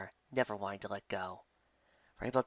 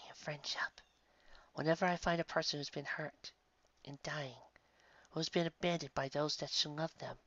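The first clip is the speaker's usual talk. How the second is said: soft, breathy kind angel; an angel soothing the girl in a whisper; gentle angel whisper; 5 semitones higher, 7 dB quieter than usual. Acted. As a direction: whisper comfort to a dying child. Angel describes her land.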